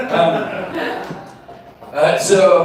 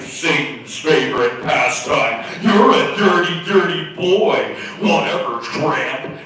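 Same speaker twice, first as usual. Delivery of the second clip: slow, scolding sermon voice, lightly done; a mild hint of a fire-and-brimstone scold; lightly voiced preachy magazine. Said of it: "magazine blaring through my mind".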